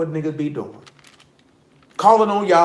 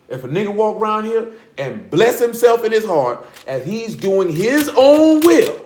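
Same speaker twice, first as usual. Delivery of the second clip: ordinary talk, nothing marked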